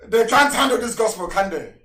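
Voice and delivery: full-force preacher's outburst; fiery preaching. Real, not acted. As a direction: thunder it at the listeners. Fiery radio sermon rant.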